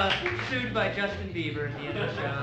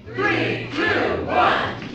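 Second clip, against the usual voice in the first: rising, louder count; calling the game start louder on each number; countdown shout; 8 semitones higher, 8 dB louder, much slower than usual. Acted.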